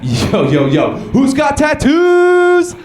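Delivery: loud, high crowd-rousing yell with a drawn-out finish; mock party-host holler; hype shout. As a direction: shout to rally the crowd, stretching the last word. Hyping up the crowd.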